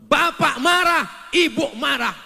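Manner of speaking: high, loud, slow marah; mock angry quarrel. Preacher describing parents fighting, mock loud quarrel. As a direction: shout both halves of the quarrel.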